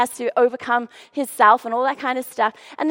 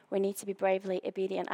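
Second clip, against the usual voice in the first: hushed and quick; 6 semitones lower, 11 dB quieter, faster than usual. Shown as a demonstration. Emphatic repeat of a key line.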